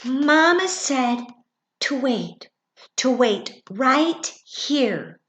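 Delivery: high, slow little fawn, not really whispered; a young fawn repeating mama's words; lightly voiced fawn, slow and high. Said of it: "whispered the fawn".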